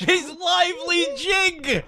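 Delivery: slow, high, through laughter; amused repeating. Real, not acted. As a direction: draw it out while cracking up. Savours absurd phrase, laughing.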